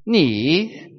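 Ni dipping down then rising up; third tone ni. Shown as a demonstration. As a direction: say Ni falling then lifting again. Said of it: "everybody try that with me".

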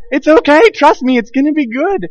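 hammed-up reassuring dad; mock reassurance. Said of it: "And I'm like".

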